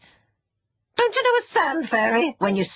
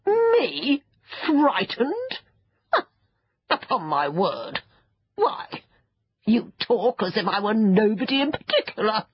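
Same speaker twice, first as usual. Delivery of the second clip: high, affronted outburst with pauses between words; puffed-up self-importance, thoroughly offended; indignant creature; 4 semitones higher than usual. Acted. Creature bristles, deeply offended.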